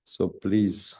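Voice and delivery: usual speaking voice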